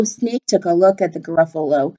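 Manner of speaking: plain reading voice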